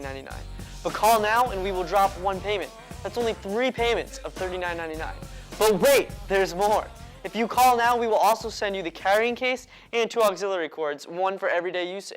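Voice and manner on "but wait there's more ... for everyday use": infomercial. Acted. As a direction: hype up the bonus offer. But wait, there's more" upsell.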